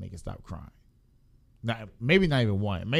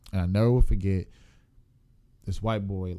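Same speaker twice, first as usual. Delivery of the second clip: ordinary talk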